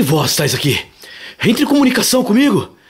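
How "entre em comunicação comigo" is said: calling out to a spirit. Real, not acted.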